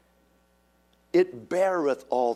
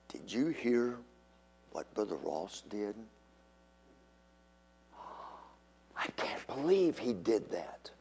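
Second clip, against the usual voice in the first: hushed gossip; 4 semitones lower, 10 dB quieter than usual. Acted. Whispered church gossip acted.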